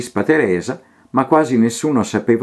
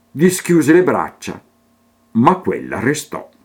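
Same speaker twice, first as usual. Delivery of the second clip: lilting sing-song verse reading; poem recitation; 5 semitones higher than usual. A narrator's reading. Reader reciting a children's poem, lilting and rhythmic. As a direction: recite it in steady rhyming rhythm.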